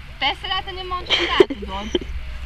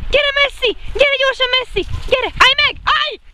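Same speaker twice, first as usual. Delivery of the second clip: excited high calling; 8 semitones higher than usual. Real, not acted.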